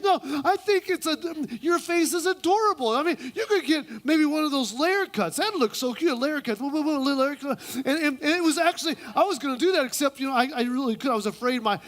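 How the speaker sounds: high, hammed-up flattery; overdone sweet friend; gushing woman. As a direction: mock-girly chatter. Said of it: "she goes".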